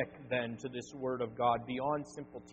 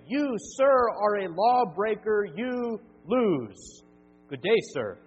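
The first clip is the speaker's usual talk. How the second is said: higher, louder furious pronouncement; Wonka-style angry verdict; 4 semitones higher, 8 dB louder, slower than usual. Acted. Preacher recasting Wonka's rant as God's law, furious shout. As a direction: thunder the sentence like Wonka.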